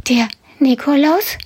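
high, disbelieving question; can't believe his ears; surprised rabbit. Acted. (ungläubige hohe Frage)

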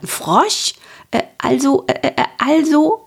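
high, halting little dragon voice, tripping over his words; stammering little dragon. A narrator's reading. The flustered little dragon to the princess, high stammering. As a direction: stammer out the "äh, also" nervously. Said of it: "stotterte der kleine Drache".

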